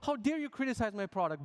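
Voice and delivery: high-pitched indignant rant; mock outrage. Developer to Hacker News critics, acting mad. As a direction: protest like a wounded, hammed-up know-it-all. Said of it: "And I got mad".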